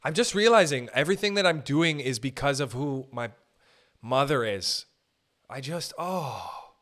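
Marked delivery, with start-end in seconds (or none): none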